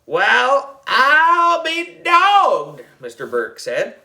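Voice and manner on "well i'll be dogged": high, loud, drawn-out country exclamation; amazed backwoods holler; folksy prospector. A narrator's reading. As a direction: marvel slowly like an old-time gold digger. Old-time groundskeeper marvels at gold.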